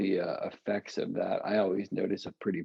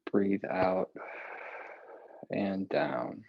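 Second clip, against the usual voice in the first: calm guided instruction. Acted. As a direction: guide each breath calmly and gently. Guides eye and neck exercises.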